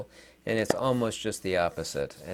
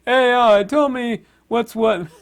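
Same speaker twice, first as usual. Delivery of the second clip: higher, louder put-on voice of someone who won't think; mock lazy asker; 6 semitones higher, 6 dB louder than usual. Vlogger mocking people who ask AI, in a higher, louder voice. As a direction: sneer at the lazy person asking AI.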